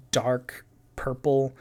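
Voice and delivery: ordinary talk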